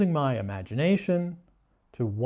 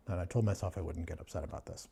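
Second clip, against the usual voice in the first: ordinary delivery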